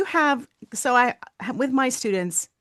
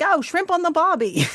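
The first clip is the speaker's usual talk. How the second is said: high-pitched comic Aussie lead-in; hammed-up Australian catchphrase; exaggerated Australian accent; 8 semitones higher, 3 dB louder than usual. Shown as a demonstration. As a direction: do a broad, jokey Australian accent. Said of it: "an Australian accent".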